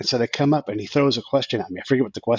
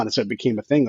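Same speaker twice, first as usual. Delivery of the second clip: ordinary delivery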